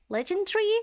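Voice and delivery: legendary? with the American four syllables, a high startled rise; "Legendary?" as leg-en-dare-y, asked high and puzzled; surprised echo. Real, not acted. Streamer puzzling over a game character's pronunciation, startled. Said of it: "Why did she say legendary?".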